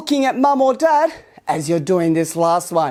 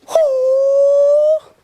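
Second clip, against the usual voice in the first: high sharp hoo cry; a loud high karate yell; sharp high-pitched hoo; 4 dB louder than usual. Shown as a demonstration. Karate teacher's crane jump cry.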